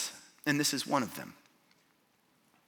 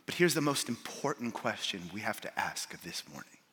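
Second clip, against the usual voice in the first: ordinary talk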